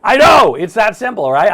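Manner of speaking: mock exasperation. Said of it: "I heard you".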